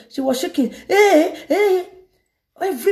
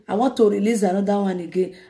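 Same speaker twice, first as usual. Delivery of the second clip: usual speaking voice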